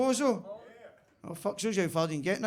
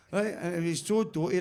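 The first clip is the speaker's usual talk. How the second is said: ordinary delivery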